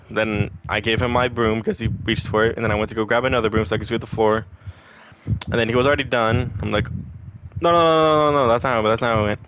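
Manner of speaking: ordinary talk, nothing marked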